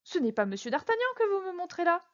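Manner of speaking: high-pitched astonished protest; object, taken aback; astonished exclamation. A narrator's reading. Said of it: "s'écria Bonacieux".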